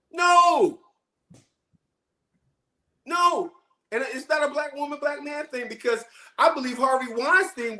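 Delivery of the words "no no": high-pitched, drawn-out no; emphatic denial. Real, not acted. Answers own question, voice jumps high.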